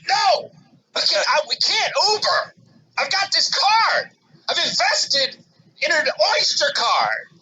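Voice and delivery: high-pitched frantic yell. Retold or imitated. Dad refusing wife's Uber idea, yelling high at London. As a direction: yell at the whole city in desperation. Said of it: "I'm not yelling at Christy. I'm yelling at London".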